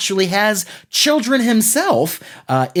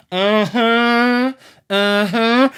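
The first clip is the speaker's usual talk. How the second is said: mom's uh-huh; 7 semitones higher, much slower than usual. Retold or imitated. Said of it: "she would just be like".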